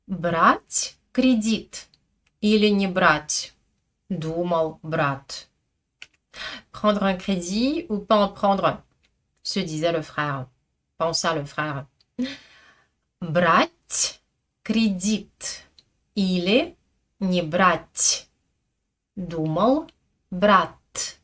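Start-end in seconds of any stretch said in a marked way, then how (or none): 0.1-5.5 slow, deliberate Russian for learners; slow repeat-after-me. Shown as a demonstration. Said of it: "répétez avec moi". 13.2-21.2 repeat-after-me reading. Shown as a demonstration. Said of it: "répétez avec moi".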